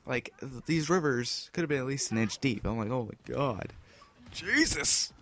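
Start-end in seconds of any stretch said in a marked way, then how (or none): 2.9-5.1 high, startled cry of alarm; shocked exclamation. Retold or imitated. Teen reacting to the flooded yard, shocked and high. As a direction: gasp out his shock at the storm.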